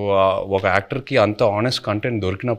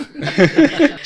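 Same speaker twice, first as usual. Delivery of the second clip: a loud high laugh that bursts out and rises; high burst of laughter; 7 dB louder than usual. Real, not acted. Actor laughs loudly at the host's slang question.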